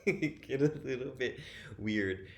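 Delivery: amused, voice lifted by a laugh. Real, not acted. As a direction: chuckle your way through the phrase. Laughs at own remark.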